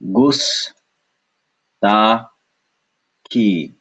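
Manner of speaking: "Gus, ta, ki" with long gaps between syllables. Shown as a demonstration. Teacher splitting for learners, "Gus, ta, ki" in separate beats.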